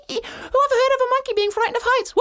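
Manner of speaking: high squealing monkey with laughter. Acted. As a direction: cackle it out like a cheeky monkey. Cheeky monkey voiced in story.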